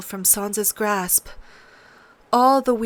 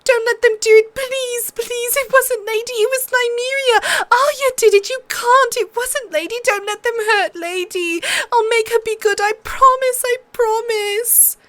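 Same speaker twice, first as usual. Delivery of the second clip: put-on much higher, desperate girl near tears; girl begging the king to spare her wolf; tearful pleading; 6 dB louder than usual. A narrator's reading. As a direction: beg through rising panic. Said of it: "She started to cry".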